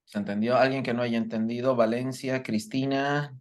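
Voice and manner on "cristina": usual speaking voice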